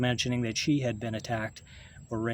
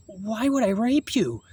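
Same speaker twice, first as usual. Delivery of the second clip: higher, slower, stunned disbelief; incredulous protest; 8 semitones higher, 3 dB louder than usual. Retold or imitated. Answering a friend who blamed him, incredulous and high. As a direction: ask it as if the accusation makes no sense. Retold reply to close person's accusation.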